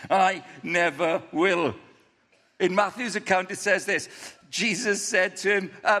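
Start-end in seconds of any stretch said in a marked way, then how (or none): 0.1-1.7 slow mock boast, each word stretched; imitating a cocksure man; cocky. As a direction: repeat the vow slowly, very sure of yourself. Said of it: "He's so cocky".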